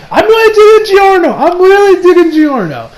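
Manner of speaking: emphatic fan excitement; gushing. Real, not acted. Emphatic repeated praise of the character.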